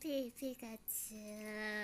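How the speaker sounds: slow stretched cartoon Pikachu cry. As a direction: drag out the creature's name. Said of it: "she can do Pikachu really well".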